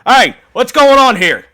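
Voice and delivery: high, loud shout of alarm; guard yelling at a scuffle. Acted. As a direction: bark at the brawlers.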